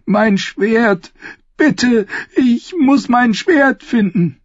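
very high, desperate wail; high-pitched, anguished begging; tearful pleading. Acted. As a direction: plead as if starting to cry. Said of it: "begann sie zu weinen".

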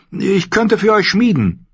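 put-on high, eager boy's voice; eager young Gendry. A narrator's reading.

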